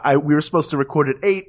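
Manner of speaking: normal talking voice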